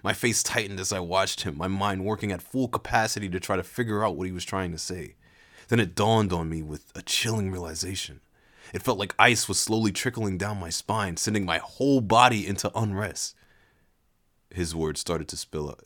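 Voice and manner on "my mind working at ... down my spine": usual speaking voice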